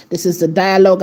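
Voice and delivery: ordinary talk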